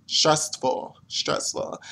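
whispered, worn-out stressful; exhausted whisper. Real, not acted. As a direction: whisper "stressful" twice, fading out. Drained venting about stress.

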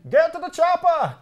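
failed Arnold impression. Shown as a demonstration. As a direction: try Arnold's Austrian accent and miss it. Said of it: "Can't do the accent".

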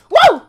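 one piercing "woo"; loud high whoop. Real, not acted. Player in mid-climb, piercing whoop.

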